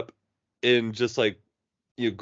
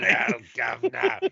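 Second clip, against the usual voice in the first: mock Cockney. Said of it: "fighting every urge in his body to go".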